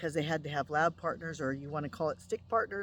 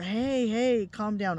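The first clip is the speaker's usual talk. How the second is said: louder, firm hey hey calm down; calming call; 4 semitones higher, 6 dB louder, slower than usual. Retold or imitated. Wife calming the screaming friend in her dream, loud and firm. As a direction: raise the voice to settle her.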